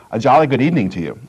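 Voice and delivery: a jolly British hello, quick and bright in tempo; British accent. Retold or imitated. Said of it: "in a very British accent".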